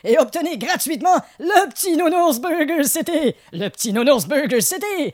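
news ad read. Acted. Mock news sponsor read.

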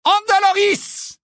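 high, slow shrieked spell; shrieked curse. A narrator's reading. Bellatrix shrieking a curse at Harry. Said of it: "s'écria".

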